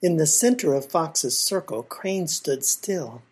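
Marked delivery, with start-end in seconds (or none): none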